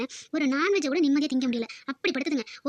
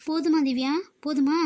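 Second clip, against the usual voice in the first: normal talking voice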